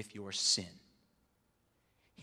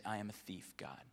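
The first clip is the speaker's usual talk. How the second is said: 1 humble confession; 4 semitones lower, 6 dB quieter than usual. Retold or imitated. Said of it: "people who come and repent and say".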